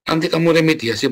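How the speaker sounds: ordinary delivery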